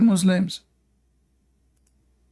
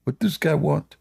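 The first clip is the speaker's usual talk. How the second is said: calm Doberman. Retold or imitated. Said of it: "the Doberman is like".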